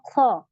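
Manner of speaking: ख as a loud throaty fricative; heavy dry kha. Shown as a demonstration. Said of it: "इसी तरीके से".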